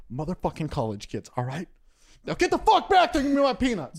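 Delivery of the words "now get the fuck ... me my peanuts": loud, high-pitched comic rant; angry outburst. Acted. Comic tells off someone over peanuts, angry outburst. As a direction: snap it out as an indignant order.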